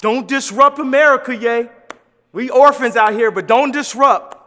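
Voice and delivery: loud, acted-out warning; scolding housekeeper. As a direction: play a housekeeper loudly telling another off. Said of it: "other housekeeper gonna tell 'em".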